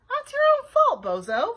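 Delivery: loud, high, firm rebuke. A narrator's reading. Babysitter rejecting Bean's excuse, firm. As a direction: tell them off without sympathy. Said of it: "said Nancy firmly".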